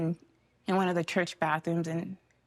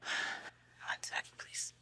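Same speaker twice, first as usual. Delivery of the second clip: faint whisper, asking for a moment; trailing off to a breathy hush; whispered pause; 13 dB quieter, much slower than usual. Real, not acted. Pausing the interview while crying, faint whisper. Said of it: "I'm getting emotional".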